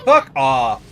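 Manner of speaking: forceful, irritated outburst; mad. Real, not acted. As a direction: swear at a pest, really annoyed. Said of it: "I'm getting mad at this game".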